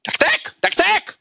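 high, drawn-out mock urging; high-pitched hurry-up mimicry; mimicked eager urging. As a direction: squeal it high and slow.

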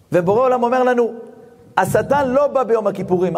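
ordinary delivery

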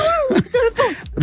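a sudden high burst of laughter. Real, not acted. Host laughs at the kids' story, high burst of laughter. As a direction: laugh in disbelief.

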